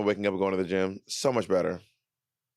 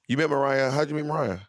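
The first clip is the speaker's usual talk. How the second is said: ordinary talk, nothing marked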